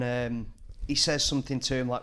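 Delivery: usual speaking voice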